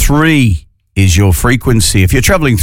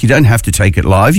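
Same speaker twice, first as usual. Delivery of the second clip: ordinary talk, nothing marked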